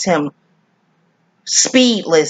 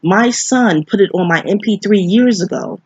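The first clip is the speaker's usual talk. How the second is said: normal talking voice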